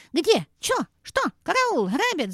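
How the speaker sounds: high startled shrieks of alarm; panicked hen cry. A narrator's reading. Startled hen cries out that she is being robbed, high panicky cries. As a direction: yelp in fright. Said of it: "закричала она".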